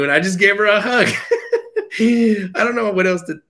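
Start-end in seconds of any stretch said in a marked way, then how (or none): 1.1-1.9 high breathy laugh. Real, not acted. Moved, laughs after the hug. 2.0-3.4 laughing. Real, not acted. Laughing after emotional story.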